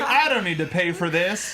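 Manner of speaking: amused, laughing through it; laughing quote. Retold or imitated. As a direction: chuckle the line out. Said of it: "He's like".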